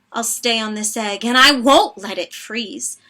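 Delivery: plain reading voice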